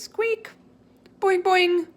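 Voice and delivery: very high, loud squeaky boing; bouncy cartoon squeak; hamster squeaks. A narrator's reading.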